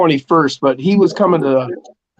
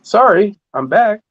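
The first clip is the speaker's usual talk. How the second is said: bright cheery take; 6 semitones higher than usual. Shown as a demonstration. Streamer showing chat how the same words change, cheery. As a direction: say it brightly, like a happy greeting. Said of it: "It's all in the way you say those three words".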